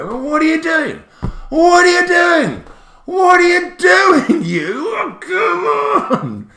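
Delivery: high, loud, stretched wail; mock outrage. Real, not acted. Teacher mock-pleading with the word buy, shrill outrage. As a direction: howl at the word in comic despair.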